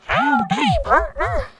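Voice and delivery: loud, high hammed-up Southern cowboy accent; mock cowboy drawl. Teasing her own country, a loud Southern cowboy accent. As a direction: belt it like a cartoon Texan cowboy. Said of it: "See, this isn't racist".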